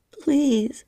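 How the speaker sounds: high pleading cry. Acted. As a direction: cry out the plea. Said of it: "I cried".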